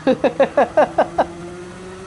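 rapid string of short laughs; real rattling laugh; staccato laugh. Real, not acted. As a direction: laugh in quick separate bursts. Laughs while racing.

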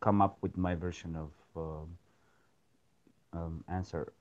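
very faint, soft voice; speaking too quietly to hear; barely audible. Real, not acted. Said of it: "your voice is very low".

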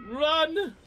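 high urgent call; urgent shout. Real, not acted. Player yelling to a teammate to flee, urgent shout. Urgent warning during the game.

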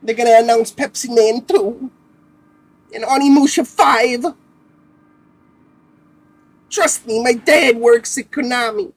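mock hyped fanboy. Mocking fans hyping game announcements in a high, loud fanboy voice. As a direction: play the deluded fan swearing the rumours are true.

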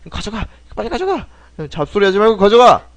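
high-pitched, impatient order; impatient shooing. Acted. Inspector tells traveller to just take it, impatient. Acting out shooing the character away.

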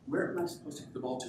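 small, soft child's voice, lightly imitated; hushed, hurried kid's question; nine-year-old daughter. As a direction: ask Dad quietly, like a lost kid. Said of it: "she looks at me and".

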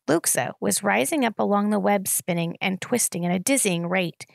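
plain reading voice

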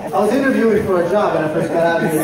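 ordinary talk

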